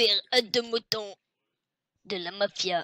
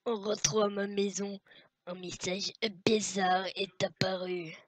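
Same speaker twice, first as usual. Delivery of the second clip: usual speaking voice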